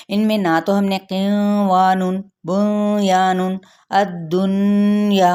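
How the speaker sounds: the words read with a hidden, nasalized noon, as not to do; deliberately wrong recitation with a blurred noon; wrong way demo. Shown as a demonstration. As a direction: show the incorrect ikhfa on बुनियानुन. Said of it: "बिल्कुल भी ऐसे नहीं पढ़ना".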